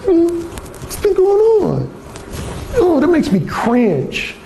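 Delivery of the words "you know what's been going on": mock phony chit-chat, higher and slow; fake-friendly drawn-out small talk; hammed-up phony caller. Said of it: "like I was doing an example earlier".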